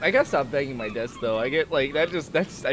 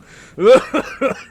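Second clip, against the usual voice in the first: loud laugh; 9 semitones higher, 10 dB louder than usual. Real, not acted. Loud laugh after line.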